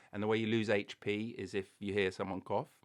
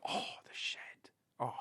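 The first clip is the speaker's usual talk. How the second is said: breathy mock-haunted groan; airy, voiceless moan of mock dismay; whispered lament; 3 semitones higher, 4 dB quieter than usual. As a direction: whisper it like a tragic memory. Mock lament over the shed story.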